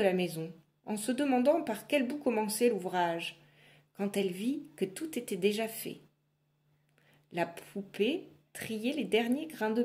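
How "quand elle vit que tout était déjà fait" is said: usual speaking voice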